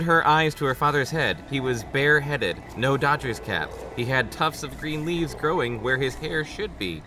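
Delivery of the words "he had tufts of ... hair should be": usual speaking voice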